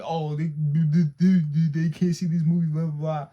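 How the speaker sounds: loud, stammering mock babble; mocking imitation. Mimicking the man who confronted him at the movies, mocking. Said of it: "He was like".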